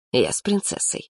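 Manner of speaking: ordinary delivery, nothing marked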